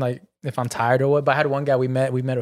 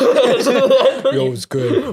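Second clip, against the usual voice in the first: mock fake voice; 11 semitones higher, 4 dB louder, much slower than usual. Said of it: "lying about my voice".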